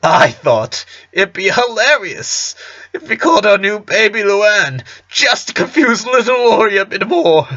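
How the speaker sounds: high-pitched, amused storytelling on the edge of laughter; tickled, fighting laughter. A narrator's reading. Father telling his son how he teased little Lori, tickled. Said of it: "had to stop for a few seconds so he could laugh".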